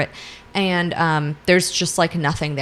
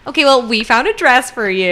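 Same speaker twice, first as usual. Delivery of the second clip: excited; 7 semitones higher, 6 dB louder than usual. Retold or imitated. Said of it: "they're like".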